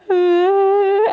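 high, loud imitated child's wail; drawn-out mock bawl; mock crying wail. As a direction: pretend to burst into tears. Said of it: "he starts crying".

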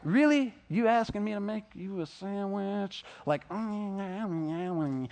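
mock-whiny, put-upon grumble. Teen whining when dad asks for a sandwich, mock-whiny. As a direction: whine like a teen asked for a chore. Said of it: "I can whine and complain".

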